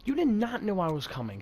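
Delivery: exasperated outburst with stretched words; real exasperation. Real, not acted. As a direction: call out someone in your way. Real outburst at another driver.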